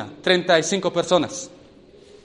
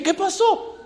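high, laughing "¿Qué pasó?"; laughing surprise; 14 semitones higher, slower than usual. Retold or imitated. Youth laughing at his own raised hand, surprised. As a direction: ask it with a startled laugh. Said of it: "Y dice".

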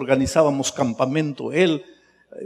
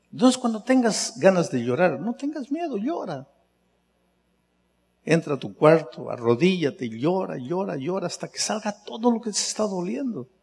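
softer, higher gentle coaxing; tender urging; 4 semitones higher, 4 dB quieter than usual. Acted. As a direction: coax them softly to let the tears out. Gentle counsel to cry.

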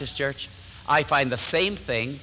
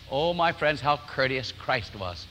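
ordinary delivery

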